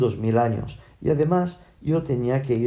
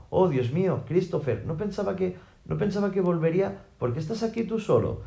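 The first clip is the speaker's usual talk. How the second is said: higher, slightly softened disbelief; stunned mother; 6 semitones higher than usual. A narrator's reading. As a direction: falter in amazement at seeing your son. Madre atónita al ver al hijo. (madre atónita, con voz más aguda)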